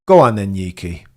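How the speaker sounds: loud cheer for the driver. Real, not acted. Streamer cheering Yuki across the line, loud.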